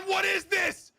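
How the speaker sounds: high, loud disbelieving cry; amazed shout. Real, not acted. Caster stunned by the play.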